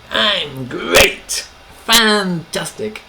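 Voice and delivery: answering how are you, gushing; over-the-top enthusiasm. Retold or imitated. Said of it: "considered both showing off a bit".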